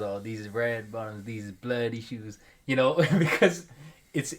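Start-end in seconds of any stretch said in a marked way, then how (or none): 0.0-2.4 low, soft and slow, a lazy [name] drawl; a low slow mock [name] line; lazy [name] imitation. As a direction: mumble it low like a rapper. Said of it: "when [name] says".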